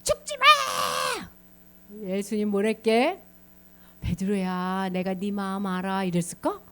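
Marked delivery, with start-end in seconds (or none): 0.0-1.3 very high, long-stretched mock wail; hammed-up tearful begging; wailing plea. Peter clinging, begging Jesus not to die. 4.0-6.0 acted kindly reassurance; gentle understanding. Imagined gentle reply, contrasted with scolding.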